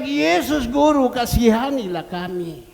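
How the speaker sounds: loud plea called out; crying out. Retold or imitated. Lepers crying out loudly to Jesus for mercy. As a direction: call to the Master for mercy. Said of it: "dan berteriak".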